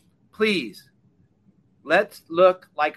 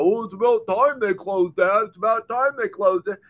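mocking online posters; 3 semitones higher, 4 dB louder than usual. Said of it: "reading all these posts about".

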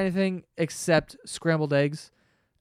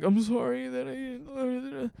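slow, higher, soft puppy apology; a slow, softer, higher sorry like a dog caught out; guilty dog voice; 5 semitones higher, 6 dB quieter, much slower than usual. Retold or imitated. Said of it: "and he's like".

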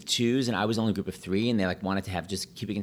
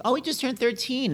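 a hint of a chirpy grandma, much higher; lightly voiced grandma. Grandma corrects the pianist from the audience, high mock voice. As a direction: pipe up like a proud granny.

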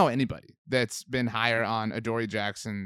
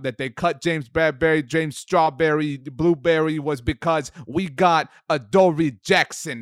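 put-on loud, higher New York sports-radio accent; 4 semitones higher, 7 dB louder than usual. Podcast co-host hypes Giants player in New York accent. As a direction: ham up a New York fan's accent with gusto. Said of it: "New York accent".

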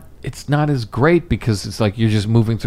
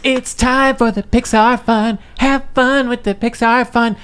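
parade announcer; 11 semitones higher than usual. Retold or imitated. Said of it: "'Cause they're like".

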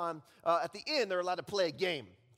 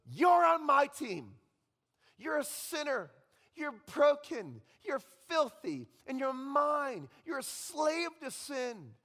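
higher, louder, slow accusing voice; accusing Satan; 7 semitones higher, 5 dB louder, slower than usual. Acted. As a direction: spit out each accusation like a gloating devil. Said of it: "he goes".

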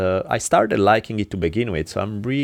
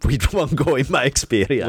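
laughing; 5 semitones higher than usual. Real, not acted. Laughs off his own point.

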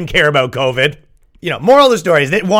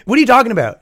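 incredulous; 8 semitones higher, 3 dB louder, much faster than usual. Retold or imitated. Incredulous reply to mom.

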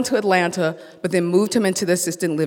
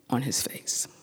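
whisper; 5 semitones lower, 10 dB quieter than usual. Real, not acted. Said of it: "horrified description, whispered".

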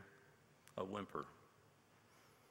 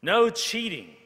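much higher, much louder shout; called out; 11 semitones higher, 13 dB louder than usual. A narrator's reading. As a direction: yell the rule from the back. Students calling out rules.

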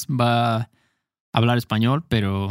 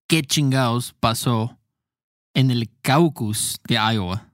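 slow model answer; slower than usual. Shown as a demonstration. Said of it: "para preguntar dirías".

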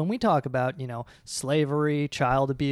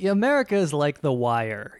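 usual speaking voice

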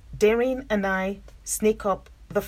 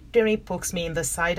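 usual speaking voice

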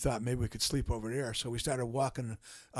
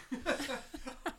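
high burst of laughter; 13 semitones higher, 8 dB quieter than usual. Real, not acted. Laugh after punchline.

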